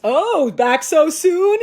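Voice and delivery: high, loud, drawn-out jibe; mock teasing. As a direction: tease slowly in an exaggerated high voice. Mimics everybody with the jokes.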